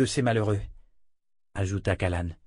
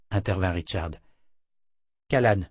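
ordinary delivery, nothing marked

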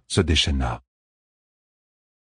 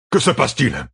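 higher-pitched, sharp demand from a general; called-out question; 5 semitones higher than usual. A narrator's reading. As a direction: throw the question out as a commander. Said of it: "lança Ituralde". (question lancée d'un ton vif)

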